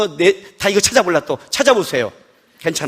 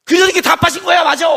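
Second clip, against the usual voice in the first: exasperated retort; 4 semitones higher, 3 dB louder than usual. Retold or imitated. Husband defending himself to his wife, exasperated. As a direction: protest building to a high shout.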